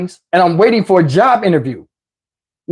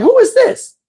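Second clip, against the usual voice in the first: much higher, startled question; high mock startled voice. Hearing a knock, asking in a high startled voice. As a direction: squeak it out in alarm. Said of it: "I'm like".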